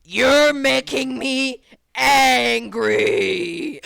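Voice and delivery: loud, extremely slow mock rage; hammed-up, drawn-out tantrum threat; imitated angry kid. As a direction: stretch it out like a kid about to explode. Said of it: "angry Minecraft Steve looking motherfucker".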